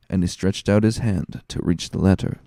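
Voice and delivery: ordinary delivery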